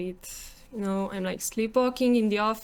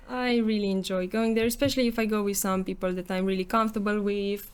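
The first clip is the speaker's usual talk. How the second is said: ordinary talk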